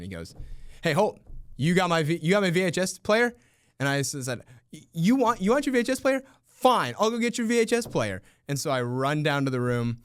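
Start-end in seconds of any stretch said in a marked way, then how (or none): 0.8-3.3 higher, louder mock call across the hall; fake-angry demand to a colleague; mock yell. Teacher calling out to colleague in front of students, mock yell. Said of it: "Mister Sheps yells at me". 4.7-8.2 much higher, louder, fake indignant; hammed-up outrage for the students; mock angry retort. Teacher snapping back at colleague in staged fight, mock anger.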